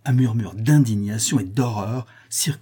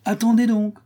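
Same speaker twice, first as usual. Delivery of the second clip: high call to hold on; interrupting; 10 semitones higher, 3 dB louder than usual. A narrator's reading. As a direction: cut off the cheers with a raised pitch. Storyteller interrupts the cheering.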